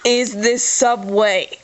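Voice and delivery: "Is this Subway?" spoken slowly, each word separated; slow, clear. Shown as a demonstration. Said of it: "speak clearly".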